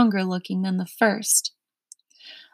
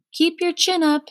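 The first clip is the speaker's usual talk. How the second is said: high called-out cheer; shouted encouragement; 8 semitones higher than usual. A narrator's reading. Humbug calling after Milo as he's led off, shouting. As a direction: call it up to him to keep spirits up. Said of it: "shouted the humbug".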